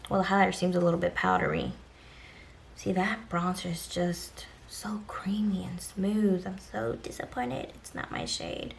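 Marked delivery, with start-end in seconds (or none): 2.8-8.7 soft disappointed whisper. Real, not acted. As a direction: breathe it out in a whisper. Whispering aside while swatching.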